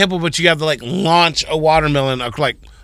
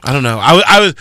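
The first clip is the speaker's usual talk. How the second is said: ordinary delivery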